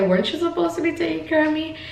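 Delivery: high, fast accusing question to husband; accusing whine. Retold or imitated. Wife blaming husband for the positive test, high accusing whine. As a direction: pitch it way up, half joking blame.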